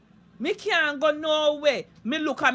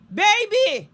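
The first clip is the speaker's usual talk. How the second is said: outraged cry; 6 semitones higher, 5 dB louder than usual. Real, not acted. Outraged at Lorraine's story.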